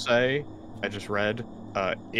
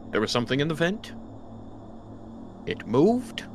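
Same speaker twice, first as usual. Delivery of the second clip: frightened report, rising higher at the end; trembling; 5 semitones higher, faster than usual. Acted. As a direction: blurt it out fast, then falter in fear. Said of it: "Trembling is gonna say".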